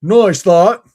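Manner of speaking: high, loud British exclamation; mock British accent. Friend plays British guy in bed, mock British accent. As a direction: blurt it like a broad British bloke. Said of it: "British guy".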